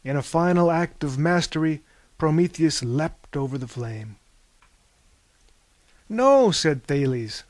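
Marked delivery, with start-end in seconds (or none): 6.1-6.5 sharp high no; loud no. A narrator's reading. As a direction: cut in with a loud no. Thales objects, corrects Prometheus.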